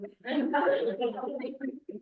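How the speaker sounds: soft, high laugh; amused laugh. Real, not acted. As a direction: laugh at your own impression. Laughs at own slow-speech imitation.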